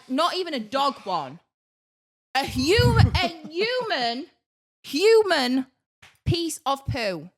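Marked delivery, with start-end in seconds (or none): 2.3-7.3 "human" drawn out, repeated with a swell; emphatic horror. Real, not acted. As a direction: hit "human" hard and slow, then pause. Stresses that it was human mess.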